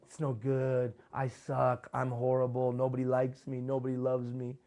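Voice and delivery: slow, mopey self-pity, slightly altered voice; lightly hammed woe-is-me whine. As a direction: moan it like a sorry-for-yourself sad sack. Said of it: "where I start saying".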